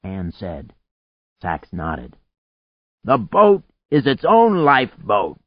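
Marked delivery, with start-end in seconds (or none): 3.0-5.4 higher, raised explaining voice; shouting. A narrator's reading. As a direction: call it out over the storm. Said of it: "he shouted".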